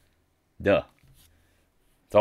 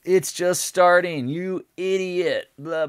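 mock yelling; 11 semitones higher, 6 dB louder, slower than usual. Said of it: "yelling at me like".

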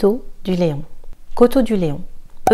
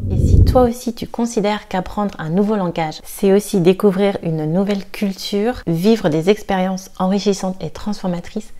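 normal talking voice

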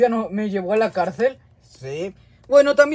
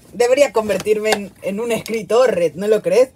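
usual speaking voice

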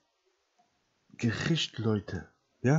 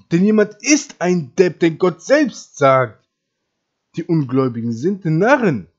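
loud, higher, hammering preacher; loud emphatic sermon delivery; forceful preaching; 4 semitones higher, 10 dB louder than usual. Real, not acted. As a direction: drive every phrase home at full volume.

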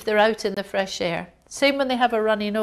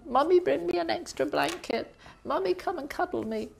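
put-on whiny child; 7 semitones higher, 4 dB quieter than usual. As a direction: beg mummy like a clingy sick kid.

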